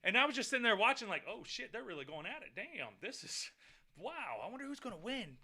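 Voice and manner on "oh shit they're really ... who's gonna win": half-whispered aside to himself, much softer; quiet awed commentary to himself; hushed inner thought. Retold or imitated. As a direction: murmur it like thinking aloud while watching. Inner thought watching fight.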